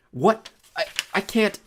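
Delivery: high, stammering disbelief; alarmed, halting protest; flustered refusal. A narrator's reading. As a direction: balk nervously at the task. Stammered refusal under pressure.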